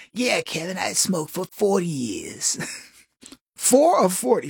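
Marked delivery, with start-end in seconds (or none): 0.1-2.6 smoker lady voice. Retold or imitated. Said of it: "if a lady talks like this".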